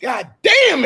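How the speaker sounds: loud, high hammed-up "God damn"; Eddie Murphy exclamation. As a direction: bellow it as the movie line again. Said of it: "He was like".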